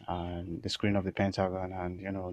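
ordinary talk

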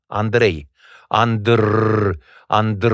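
"Andr" with the R rolled long on the tongue tip; trilled R. Shown as a demonstration. Actor demonstrating R vibrations, strongly trilled R. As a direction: roll out the R in "Andr" firmly. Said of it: "trebuie să fie patru".

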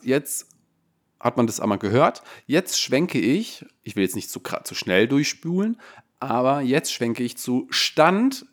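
ordinary talk

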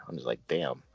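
normal talking voice

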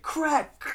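high-pitched mimicked snapping noise; imitated bone crack. Retelling woman breaking his arm, imitated crack.

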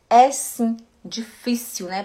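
ordinary talk, nothing marked

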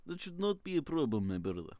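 put-on playful Ukrainian accent; quick burst of Ukrainian accent. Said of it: "full-on Ukrainian accent".

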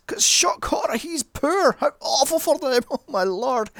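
high, loud sham outrage; mock horror. Reviewer mocking the film's horror at poor Pete, mock horror.

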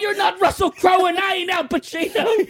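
high-pitched comic shouting; hammed-up boss losing it at staff; frantic mock rage; irate boss. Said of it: "your boss, he's grabbing you".

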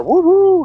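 high siren wail, woo woo; imitated siren. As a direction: mimic the fire engine arriving. Imitating a fire truck siren.

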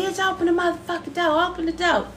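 high, put-on yelling voice; hammed-up demand from outside; mock yell at the door. Someone beating at the door.

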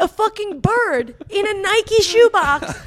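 high, loud, slow disbelief; can't believe the bird in the box; incredulous high exclaim. Real, not acted. Disbelief at pigeon in shoebox.

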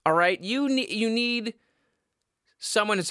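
ordinary delivery

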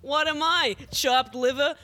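put-on old borscht belt comic timing; eighties comedian. Shown as a demonstration. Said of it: "as though I'm a comedian in the eighties".

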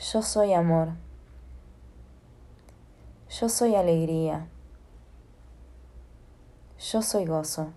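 measured affirmations with long pauses and falling endings; unhurried guided-affirmation voice; guided meditation. Acted. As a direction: lead a calm affirmation exercise, leaving room to repeat.

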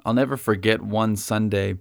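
ordinary delivery

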